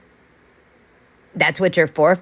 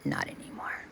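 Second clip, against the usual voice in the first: softer unvoiced whisper; whispered aside; 16 dB quieter than usual. Real, not acted. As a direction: drop to a secret whisper. Aside after rant.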